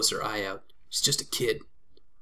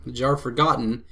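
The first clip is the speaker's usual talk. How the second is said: ordinary talk, nothing marked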